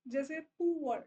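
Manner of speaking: ordinary talk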